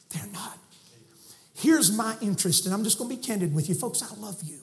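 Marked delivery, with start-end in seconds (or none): 0.1-0.6 soft whispered echo. Real, not acted. Preacher whispering the echo to the crowd. 3.7-4.6 much softer, tender. Real, not acted. Preacher softly telling the congregation he loves them.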